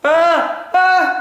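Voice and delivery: short high-pitched startled cries; two sudden high yelps of fright; frightened yelps. Real, not acted. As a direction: yelp in alarm at the hornet. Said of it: "This is terrifying".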